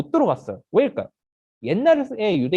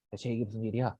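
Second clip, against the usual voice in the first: low, hushed puzzled aside; murmuring; 8 semitones lower, 10 dB quieter than usual. Acted. Crowd whispering about the miracle, murmuring. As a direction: murmur it under your breath. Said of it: "다 수군거려요".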